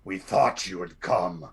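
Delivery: a hint of a low growl, as a wolfen lookout. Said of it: "growls out".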